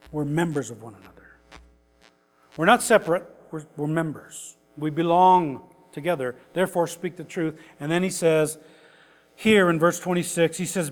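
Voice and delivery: usual speaking voice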